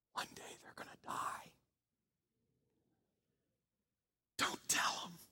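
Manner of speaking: playful whispered secret; stage whisper. Acted. Pastor sharing a joking secret, whispered. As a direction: lean in and whisper it to the room.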